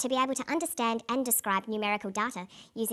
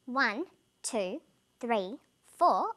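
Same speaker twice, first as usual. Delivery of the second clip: ONE, TWO, THREE, FOUR as separate, evenly spaced beats; steady, spaced-out count; beat on numbers; much slower than usual. Shown as a demonstration. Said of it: "Notice how I can read the numbers".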